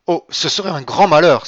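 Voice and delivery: high, very loud outcry; alarmed exclamation. A narrator's reading. Villefort dreading the marriage falling through, crying out. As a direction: cry out in alarm, voice leaping up. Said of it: "s'écria Villefort".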